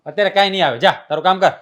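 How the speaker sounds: loud, high refusal; angry shout. Acted. Husband yelling at wife over her list, angry shout. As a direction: shut her down hard.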